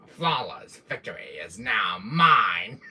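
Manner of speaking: slow, triumphant villain proclamation; Wesker voice. Acted. Streamer proclaiming his win as his villain, slow and triumphant. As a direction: declare victory like a gloating villain. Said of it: "I love doing my Wesker voice".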